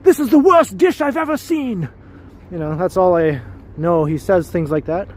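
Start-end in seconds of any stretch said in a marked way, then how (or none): 0.0-1.9 high, loud British chef tirade; mock-furious celebrity chef berating a cook; Gordon Ramsay yelling. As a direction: yell it with an exaggerated British accent. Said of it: "he yells a lot".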